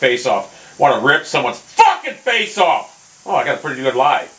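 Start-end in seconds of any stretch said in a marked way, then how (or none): 0.8-3.2 slow angry build into a yell; seethe, then burst out on the curse; mad. Real, not acted. Gamer wanting to rip faces off, mad outburst. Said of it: "I'm so mad right now".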